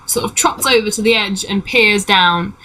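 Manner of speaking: ordinary talk, nothing marked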